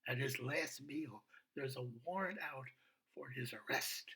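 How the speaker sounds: ordinary talk, nothing marked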